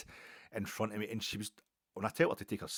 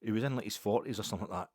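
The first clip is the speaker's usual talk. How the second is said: ordinary talk, nothing marked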